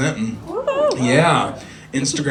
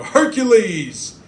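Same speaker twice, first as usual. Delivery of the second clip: quote shout; 6 semitones higher, 3 dB louder, much slower than usual. Retold or imitated.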